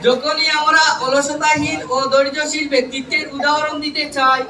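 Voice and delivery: loud, measured public recitation of verse; poem recitation. Acted. Trainee reciting a farewell poem to college teachers, declaimed loudly. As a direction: declaim rhymed tributes line by line, pausing between phrases.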